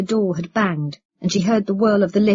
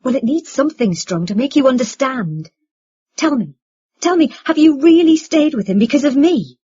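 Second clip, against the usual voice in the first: raised, higher, pressing voice; insistent demand; 5 semitones higher, 7 dB louder than usual. A narrator's reading. Son demands the truth from his mother, insistent. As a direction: build to a loud, insistent question.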